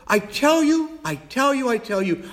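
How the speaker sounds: high, emphatic repeated I tell you; rising preacher's insistence; emphatic preaching. Real, not acted. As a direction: drive home I tell you each time. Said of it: "preaching at full force".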